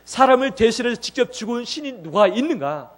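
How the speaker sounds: loud, rising preacher's challenge; forceful preaching. Acted. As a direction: press the question on the congregation.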